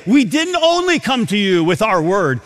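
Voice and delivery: usual speaking voice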